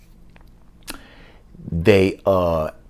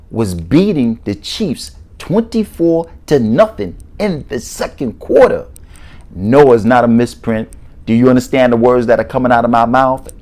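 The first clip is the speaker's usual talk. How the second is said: loud disbelief; 3 semitones higher, 6 dB louder than usual. Real, not acted. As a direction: hammer it out loudly in amazement. Incredulous recap of a shocking score.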